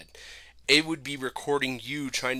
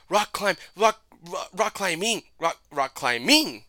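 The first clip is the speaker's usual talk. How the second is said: "rock climbing" repeated high, each try stressed differently; shifting inflection; 7 semitones higher, 3 dB louder than usual. Retold or imitated. Imitating Amory guessing in the game, "rock climbing" in shifting inflections. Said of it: "they put different inflection".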